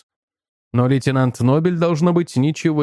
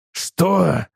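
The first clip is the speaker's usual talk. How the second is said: barked question without real volume; an understated angry yell; mild narrated yell; 3 semitones higher than usual. A narrator's reading. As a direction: snap it at the waiter. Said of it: "заорал на него Брин".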